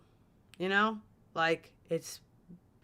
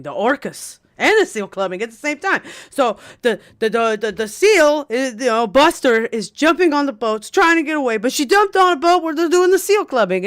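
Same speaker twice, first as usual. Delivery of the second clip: higher, louder, rushing rant; excited rant; 7 semitones higher, 12 dB louder than usual. Real, not acted. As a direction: get worked up about it. Excited rant about Buster.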